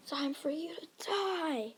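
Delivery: high, half-whispered war cry; hushed mock yell as you charge; battle threat. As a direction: stretch the threat high without shouting. Hero charges at villain.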